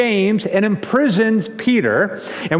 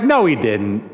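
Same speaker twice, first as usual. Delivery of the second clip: much lower, mock-skeptical denial; dismissive disbelief; 9 semitones lower than usual. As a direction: drop low to play the doubters. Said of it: "and they're like".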